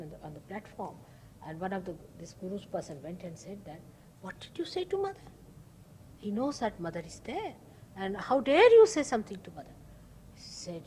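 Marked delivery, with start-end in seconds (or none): 4.2-9.7 indignant rebuke. Retold or imitated. Guru's man rebukes disciple.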